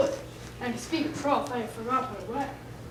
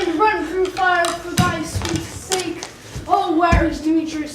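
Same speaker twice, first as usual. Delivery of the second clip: passionate outburst; 4 semitones higher, 7 dB louder than usual. Acted. Enchanted Lysander waking to Helena, loud ardent vow. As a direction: burst awake in love.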